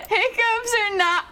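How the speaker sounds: high, drawn-out tearful cry of frustration; exasperated wail. Real, not acted. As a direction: wail it out between hiccups. Exasperated outburst over unstoppable hiccups.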